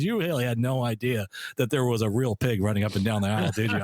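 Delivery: normal talking voice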